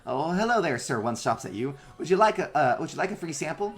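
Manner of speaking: high, eager robot salesman; runner bot voice. Acted. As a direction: push the free sample like a perky bot. Runner bot offering sample.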